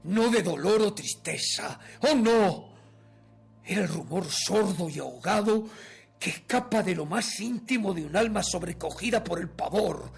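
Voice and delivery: loud, impassioned gothic narration; terror exaltado. A narrator's reading. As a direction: surge in volume, reliving the dread. (narración de terror exaltada y fuerte)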